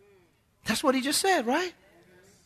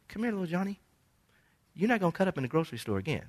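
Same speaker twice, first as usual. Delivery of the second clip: acted parent, softly warning a child; hushed parental warning; 2 semitones lower, 8 dB quieter, much faster than usual. Parent quietly warning child in store.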